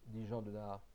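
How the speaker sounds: ordinary delivery, nothing marked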